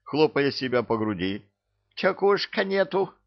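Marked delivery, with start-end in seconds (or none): 1.9-3.1 put-on high, broken accented voice; high broken-speech voice. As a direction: squeak it indignantly. Akira character voice.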